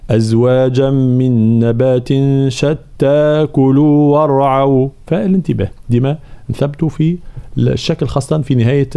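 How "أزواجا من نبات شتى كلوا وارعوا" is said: شتى كلوا joined without a stop; slow recitation read through. Shown as a demonstration. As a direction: carry شتى straight into كلوا. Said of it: "فحال الوصل".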